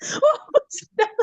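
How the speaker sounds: very high, slow laughing squeal; cringing laugh. Real, not acted. Squealing at her own memory to the interviewer. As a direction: squeal at your own past self. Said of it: "I cringe".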